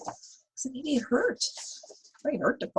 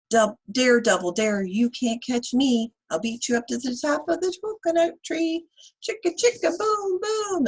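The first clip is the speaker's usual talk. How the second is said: higher, teasing dare in a little letter's voice; taunting dare; 6 semitones higher, 6 dB louder than usual. Acted. Letter A daring the others, higher and teasing. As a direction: taunt like a cheeky kid daring a friend. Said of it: "this is what he said".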